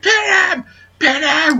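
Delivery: mock shrill yell, very high-pitched; Sherri's shrilly yell. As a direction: imitate a shrill ringside yell without much volume. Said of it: "with her shrilly voice".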